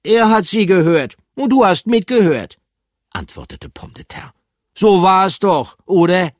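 high, loud cheeky retort; teasing character voice. Acted. Pomme de Terre teases Bertram, high and loud. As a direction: needle him playfully.

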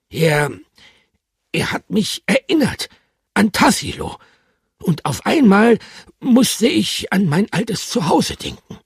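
high, loud, a touch tearful; tearful hamster. Acted. Bertram remembers his old home, mildly tearful. Eine dicke Träne kullerte.

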